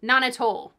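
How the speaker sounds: ordinary talk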